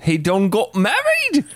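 high, slow hillbilly drawl; mock Southern, surprised and slow.

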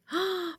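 high, soft intake of breath; delighted gasp. A narrator's reading. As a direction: gasp in admiration. Admiring the photos just taken. (obdivné zalapání po dechu)

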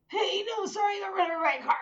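high, squeaky, tearful-sounding apology; frantic apology. Retold or imitated. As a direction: blurt it out high and fast, half laughing. Acted frantic phone call to sister.

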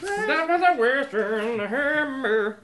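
hammy drawn-out voice. Pretend. Joking exclamation.

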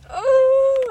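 loud high drawn-out groan; long groan. Retold or imitated. Home on the couch, a long high groan. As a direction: let out a long exhausted moan.

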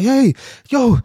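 acting out a frantic robbery victim; shocked panicked pleading. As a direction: blurt it out in a re-enacted panic. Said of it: "I'm just shocked".

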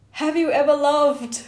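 loud pained shout from the crowd; shouted from the audience. Retold or imitated. Audience member shouting to the comedian, pained and loud. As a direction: yell it across a theatre like it hurts. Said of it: "somebody from the audience shouted".

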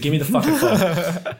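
mock-demanding Karen, breaking into laughter; full Karen. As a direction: retell an indignant customer's demand. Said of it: "And I went full Karen".

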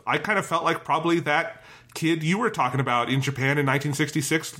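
usual speaking voice

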